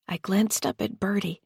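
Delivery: plain reading voice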